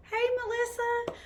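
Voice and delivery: sing-song greeting. Real, not acted. Greeting a viewer in the live chat.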